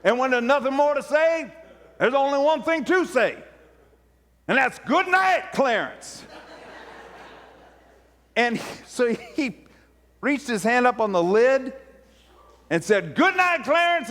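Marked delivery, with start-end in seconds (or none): 0.0-4.8 high, emphatic pulpit cadence; preacher speaking into the casket; raised preaching voice. Retold or imitated. Preacher building to finish. 4.8-6.2 high, ringing good night; preacher bidding the corpse good night; preacher's farewell call. Retold or imitated. 13.1-14.1 high, ringing good night; preacher bidding the corpse good night; preacher's farewell call. Retold or imitated. Slams casket lid.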